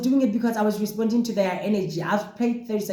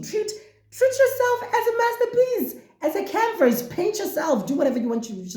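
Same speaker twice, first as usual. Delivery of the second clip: much higher, laughing encouragement; exuberant cheer. Real, not acted. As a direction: lift them up with delight. Exuberant pep talk.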